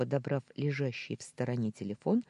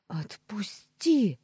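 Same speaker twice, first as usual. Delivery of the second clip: hissed; 7 semitones higher, 4 dB louder, much slower than usual. A narrator's reading. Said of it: "зашипела она".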